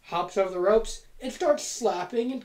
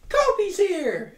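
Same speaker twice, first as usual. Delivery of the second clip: mock excited cry; 11 semitones higher, 3 dB louder, slower than usual. Friend's excited greeting.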